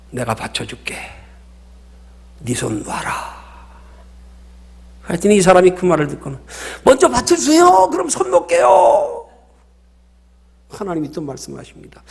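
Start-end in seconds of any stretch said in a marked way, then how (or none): 0.1-3.4 low, soft, slowing divine voice; voice of God. Acted. God speaking to the dangling climber, low and soft. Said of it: "하나님의 음성이 들리더랍니다". 6.8-9.3 loud, high-pitched desperate bargaining; acted panicked plea. Climber begging God to hold him first, acted panic.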